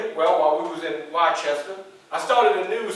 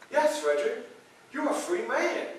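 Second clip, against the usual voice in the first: lady's news; 8 semitones higher, 6 dB quieter than usual. Retold or imitated. The lady confirms his freedom.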